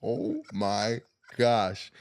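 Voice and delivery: slow stunned "oh my gosh"; drawn out in shock. Retold or imitated. Stunned reaction.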